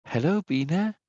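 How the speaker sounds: attempted female voice, pitched high; Norma. Streamer says hello to Bina for Norma, attempted impression. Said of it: "can I do Norma's accent?".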